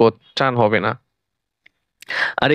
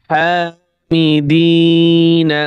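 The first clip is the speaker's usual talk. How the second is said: slow drawn-out reading; 3 semitones higher, 4 dB louder, much slower than usual. Shown as a demonstration. Teacher to students, showing how to blend the syllables, slow chanted reading. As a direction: stretch every syllable, very slow and sung out. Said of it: "বানান করে পড়বেন".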